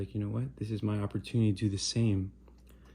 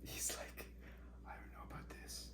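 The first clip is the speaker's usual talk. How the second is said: hushed whisper, barely any voice; 6 semitones higher, 22 dB quieter than usual. Real, not acted.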